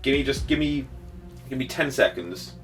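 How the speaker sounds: ordinary talk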